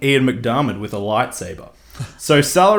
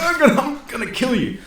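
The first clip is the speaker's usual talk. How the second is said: high threat broken by laughter; laughing mock threat; 12 semitones higher, faster than usual. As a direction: giggle through Han's threat. Repeats Han's threat, laughing.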